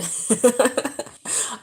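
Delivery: high, loud cackling laugh; burst of laughter. Real, not acted. Vlogger laughing at being weird, high loud cackle.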